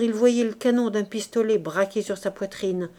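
plain reading voice

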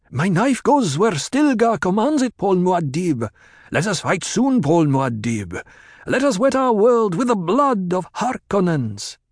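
high, fervent cries from a crowd; calling out. A narrator's reading. Followers pledge themselves as he passes, high fervent calls. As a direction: call it out to the passing leader. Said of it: "Voices called out to him".

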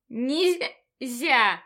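loud tipsy hiccuping cry; drunk with hiccups. Acted. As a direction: hiccup out the word. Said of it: "снова икнула я". (пьяный выкрик с иканием)

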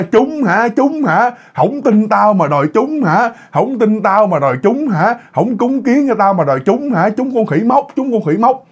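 high-pitched jeering taunts; mocking scolding. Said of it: "Chửi quá trời chửi".

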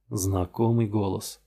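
usual speaking voice

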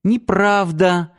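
put-on high, drawn-out little-girl voice; childlike denial. A narrator's reading. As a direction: play the little one, stretching it out. Girl snuggles up, denies growing up. (по-детски, тоненько и протяжно)

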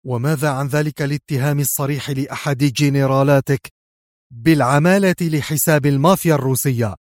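ordinary delivery, nothing marked

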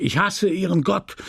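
blasphemous outburst. A narrator's reading. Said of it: "Charles fluchte lästerlich".

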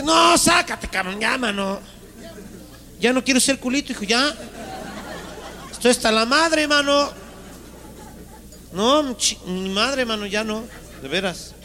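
high whiny cartoon voice. Butt character whining to God, high and squeaky. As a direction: whine it like a squeaky cartoon critter.

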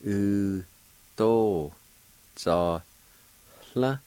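each syllable of "U, to, za, la" drawn out and separate. Shown as a demonstration. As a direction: say "U, to, za, la" slowly, pausing after each syllable, faint H before the L. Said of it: "It's pronounced like this".

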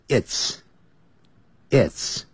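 "its" said slowly as a single short syllable; contracted its. Shown as a demonstration. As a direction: draw out "its" as a tight contraction. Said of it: "就念成its".

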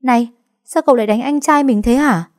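high, loud indignant yell; shout. Acted. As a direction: yell at him to stop hitting. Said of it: "Lan Trinh liền hét lên".

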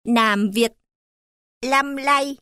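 put-on rural Northern Vietnamese accent, n and l swapped; Northern Vietnamese farmer. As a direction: imitate a Northern farmer mixing up l and n. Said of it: "nông dân miền Bắc mặc áo quần nâu nói".

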